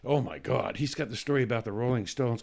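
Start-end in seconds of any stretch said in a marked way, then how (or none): none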